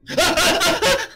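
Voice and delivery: very high burst of frightened laughter-like cries; startled shriek. Real, not acted. Checks on monster, gets scared.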